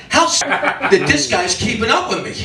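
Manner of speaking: comic self-directed outrage; mock mad at himself. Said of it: "I got mad".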